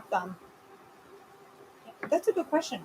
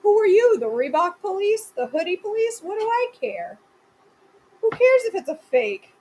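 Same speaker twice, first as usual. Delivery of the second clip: indignant; 7 semitones higher than usual. Retold or imitated. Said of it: "And I'm like".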